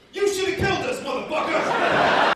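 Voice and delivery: high, loud enraged shout; furious yell. Retold or imitated. Passenger yelling at the pilot over the intercom, high and loud. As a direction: yell it at the pilot, stretching the last word.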